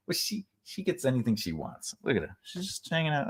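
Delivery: ordinary talk, nothing marked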